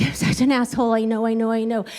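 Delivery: usual speaking voice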